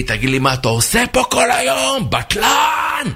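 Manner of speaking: high, strained tirade; hammed-up high angry shout; angry boss. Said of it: "אדון משה עצבני".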